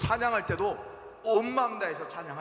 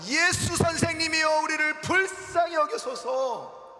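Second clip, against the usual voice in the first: 예수 선생님이여 cried out higher and louder; loud plea; 7 semitones higher, 5 dB louder, slower than usual. Acted. Lepers crying out to Jesus for mercy, loud and high. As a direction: beg loudly, 불쌍히 stretched out. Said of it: "그렇게 큰 소리로 외쳤다고".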